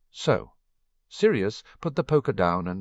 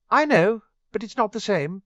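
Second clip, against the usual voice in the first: put-on whiny teen, pitched much higher and louder; a teen whining that his friends are far away; small whine; 7 dB louder than usual. A narrator's reading. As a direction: let a sulky whine creep in. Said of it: "a small whine in his voice".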